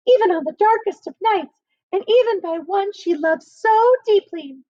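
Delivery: squeaky put-on lady voice through the shutter; man mimicking a woman in a high falsetto; high false woman's voice. A narrator's reading. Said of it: "a voice as high as a filleted cod".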